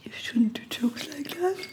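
soft, voiceless whisper; hushed reproach. Real, not acted. Co-host chiding joke teller, hushed whisper. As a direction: chide him under your breath in a whisper. Chides him quietly after racist joke.

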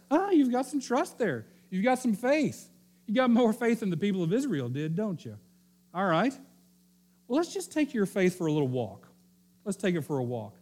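a hammed-up taunting tempter; sly devil. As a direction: tease him into proving it. Devil voiced, impressed and taunting.

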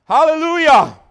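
high, loud exultant shout; hallelujah shout. Real, not acted. Preacher shouting praise to the congregation. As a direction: let it ring out in praise.

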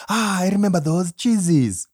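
wistful groan of memory; nostalgic groan. Pretend. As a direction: sigh it like a fond memory. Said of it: "people in the diaspora remembering".

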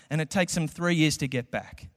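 usual speaking voice

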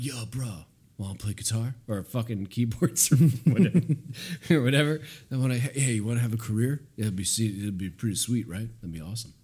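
mocking laid-back bro pitching a band; imitated bro-speak with a laugh breaking in; casual bro. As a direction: do a breezy dude talking his buddy into it. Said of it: "ran into his bro Keith".

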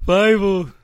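big dopey cat voice, loud and slow; Tiger's voice. Acted. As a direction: boom it out like a dim cartoon cat. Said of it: "It's fun to do Tiger's voice".

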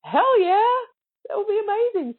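high and loud eager burst; excited yes. Retold or imitated. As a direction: jump at the offer, thrilled. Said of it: "And I'm like".

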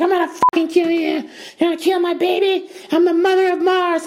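high shrieking mock fury; crazed woman falsetto. Woman threatening the stranger, high shrieking mock rage. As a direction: screech it like a mad mother. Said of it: "she's like".

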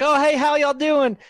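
high, loud imitated Alabama greeting; thick Alabama accent. Scene: girl skiing up to greet the group, thick Alabama accent. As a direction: imitate a chirpy Alabama hello. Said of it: "She had a really thick Alabama accent".

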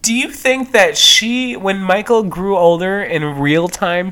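ordinary talk

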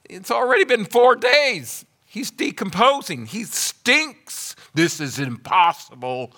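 high, loud mock complaining sister; hammed-up high whine of a doubting sister; whiny sister. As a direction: play the sister's exasperated objection. Sister's hopeless complaint retold.

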